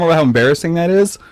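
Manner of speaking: ordinary delivery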